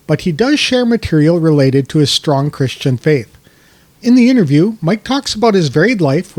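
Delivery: polished, projected introduction of a guest; podcast intro read. Acted. Host introducing the guest to listeners, scripted announcer read.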